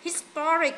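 "historic" with TOR drawn out; stress on second syllable. Shown as a demonstration. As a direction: lean hard on the middle of historic. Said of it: "nhấn âm hai".

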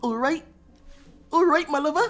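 high, rising go at a Bristolian accent; attempted Bristolian accent. Shown as a demonstration. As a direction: have a bold try at Bristolian twang. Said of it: "using the best Bristolian twang".

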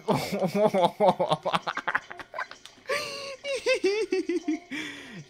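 long, high laughing fit; big loud laugh climbing into a squeal; helpless laughter. Real, not acted. Player cracking up at what just happened in the game, helpless laughter.

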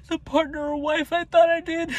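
pushing the words out near tears; real heartbreak rising into a strained high voice; voice breaking. Real, not acted. Realizing he lost his partner.

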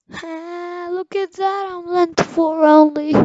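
excited. Real, not acted. Excited over game progress.

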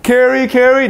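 put-on high pleading toddler voice; whining toddler. Toddler begging mommy to carry him, high whine. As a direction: beg to be picked up. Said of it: "is like".

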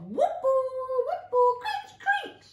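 sharp, piercing high whoops; loud, screechy chorus of frogs; screechy frog calls. A narrator's reading. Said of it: "The sounds were screechy, the sounds were sharp".

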